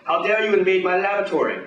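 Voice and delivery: put-on outraged villain with a French accent. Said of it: "pronounces the laboratory like some kind of Frenchie".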